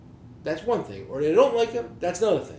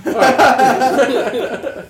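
big laugh through the words; laughing loudly; 7 semitones higher, 13 dB louder than usual. Real, not acted. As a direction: burst out laughing as you say it. Laughing after his own joke.

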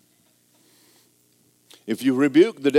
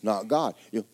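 ordinary delivery

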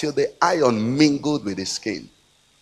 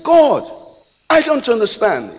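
high, loud mock complaint; pitched-up bewildered appeal; imitated plea to God; 9 semitones higher, 6 dB louder than usual. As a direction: act out a man protesting to God. Retells a man's complaint to God.

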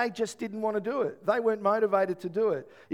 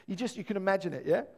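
ordinary talk, nothing marked

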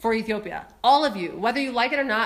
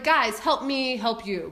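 slow pleading; 2 semitones lower, much slower than usual. Retold or imitated. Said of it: "I'm like".

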